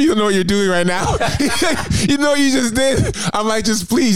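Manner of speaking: high, pleading through laughter; laughing plea. Retold or imitated. To the officer, begging through laughter to not go. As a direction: beg him laughing at your own panic.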